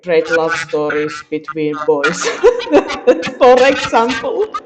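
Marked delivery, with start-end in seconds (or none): 2.2-4.5 laughing through the words. Real, not acted. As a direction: giggle out the end of the sentence. Laughing while telling about love stories.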